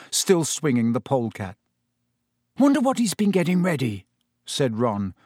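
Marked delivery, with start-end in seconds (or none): 2.6-4.1 higher, mildly uneasy wondering; note of anxiety. A narrator's reading. Ron wondering about Hagrid's lesson, a touch anxious. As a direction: let a little worry show. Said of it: "a note of anxiety in his voice".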